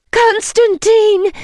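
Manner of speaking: high, loud, drawn-out cry; frightened cry. A narrator's reading. Woman cries out to vampire, frightened. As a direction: cry out his name in fear. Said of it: "I cried".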